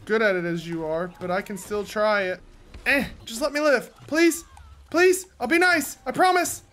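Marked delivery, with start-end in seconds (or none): 2.9-6.6 high, loud, insincere begging; fake pleading. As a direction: beg for your life, not meaning it. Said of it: "She knew I was lying".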